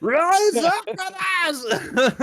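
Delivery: high shouted rallying cry; fiery revolutionary speech. Pretend. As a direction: rouse the masses, hammed up. Mock rally cry in group chat.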